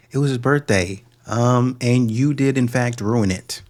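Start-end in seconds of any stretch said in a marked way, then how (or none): none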